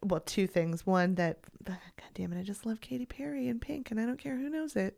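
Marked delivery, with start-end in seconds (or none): 1.6-5.0 hushed confession; whispering. Real, not acted. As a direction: whisper it like a secret. Confessing a guilty pleasure.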